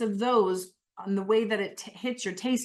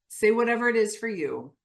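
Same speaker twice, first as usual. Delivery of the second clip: ordinary talk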